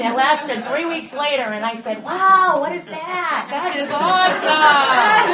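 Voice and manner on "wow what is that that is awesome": loud, high, drawn-out amazement; an exuberant retold "wow" at full volume; blown away. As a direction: whoop it out in awe, way up high. Retold amazement after her high.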